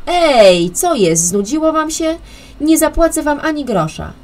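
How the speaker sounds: loud, high angry yelling; angry shout. A narrator's reading. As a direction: yell at the vanished band. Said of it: "krzyczał".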